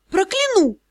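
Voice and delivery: high-pitched furious threat; angry vow. A narrator's reading. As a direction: snap back with a curse. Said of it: "пообещала злая я".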